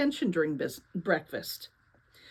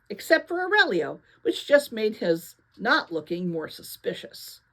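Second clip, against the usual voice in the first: plain reading voice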